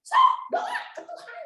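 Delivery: mocking, high dare to pray; challenging dare. Angry wife challenging husband to pray, taunting. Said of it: "ngajak doanya nantang".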